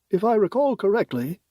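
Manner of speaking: lightly voiced president. DeAndre speaks.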